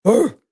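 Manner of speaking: gruff imitated dog bark; short rough "Arr" bark; old dog bark. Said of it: "He sounded old when he would bark".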